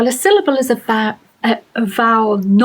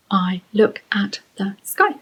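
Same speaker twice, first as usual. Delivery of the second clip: "I look at the sky" in five detached beats; counted syllable by syllable. Shown as a demonstration. Teacher repeating the count, "I look at the sky" said word by word. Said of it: "Five syllables".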